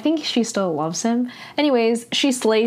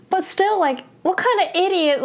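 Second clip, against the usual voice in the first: high-pitched indignant rant; exasperated anger; 10 semitones higher than usual. Real, not acted. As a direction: rail at a character's stupidity. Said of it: "I was very angry when I saw this".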